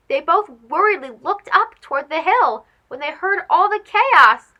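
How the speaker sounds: ordinary delivery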